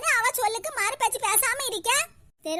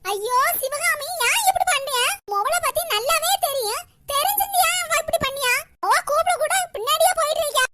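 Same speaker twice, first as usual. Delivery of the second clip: dismayed wail; 6 semitones higher, 3 dB louder than usual. Acted. Grandmother laments Sivakami's foolishness.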